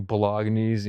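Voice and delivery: "bolognese" as buh-LOG-neez; slow "bolognese" with a hard g; Americanized pronunciation. Shown as a demonstration. Diner about to be corrected, "bolognese" said buh-LOG-neez. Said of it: "when you say".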